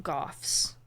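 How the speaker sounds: whispered Goths. Real, not acted. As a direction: breathe the word Goths. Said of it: "whispered answer".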